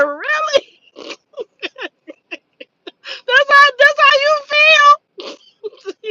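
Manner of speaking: high-pitched, drawn-out mock disbelief; incredulous comeback. Retold incredulous comeback to sister.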